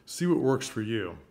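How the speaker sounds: usual speaking voice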